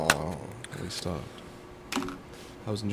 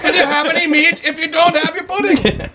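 laughing mock teacher. Mocking a strict teacher, laughing.

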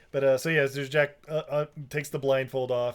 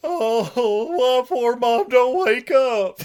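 loud, high, hammed-up innocent; overdone drawn-out innocent voice; mock super innocent; 10 semitones higher, 10 dB louder, slower than usual. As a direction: wail it with exaggerated naivety. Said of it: "super innocent or whatever".

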